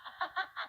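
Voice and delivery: high bursting laugh; burst of laughter. Acted. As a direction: break out laughing helplessly. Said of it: "Loses it in fits of laughter".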